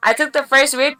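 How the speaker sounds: ordinary delivery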